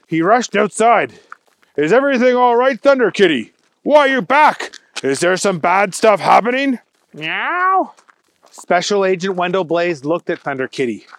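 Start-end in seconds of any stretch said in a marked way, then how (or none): 1.8-6.8 fast, higher, louder anxious questions; worried rush. Acted. Agent rushes out to cat. 7.1-7.9 high mewing kitten voice; cat meow. Acted. Cat answers agent.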